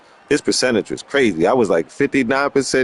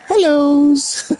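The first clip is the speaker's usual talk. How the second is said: pitched-up sing-song hellos; high mock greeting. Teasing a viewer's greeting, high chirpy hellos. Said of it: "She said".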